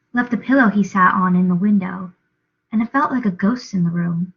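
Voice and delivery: ordinary delivery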